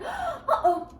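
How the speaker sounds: high squeaky uh-oh with a gasp and laugh; playful fake fright for the kids; mock alarm. Reader gasps in mock alarm.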